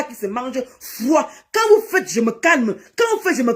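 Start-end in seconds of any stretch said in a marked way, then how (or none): 1.5-2.1 sudden high heated burst; high-pitched indignant jab; angry outburst. Real, not acted. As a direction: snap it out high. Heated rant.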